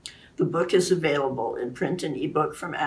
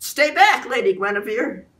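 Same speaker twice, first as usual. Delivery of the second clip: much higher, louder shout of warning; urgent warning; 7 semitones higher, 7 dB louder than usual. A narrator's reading. Lancelot warning Guinevere away from the sword, urgent shout. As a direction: shout her back from danger.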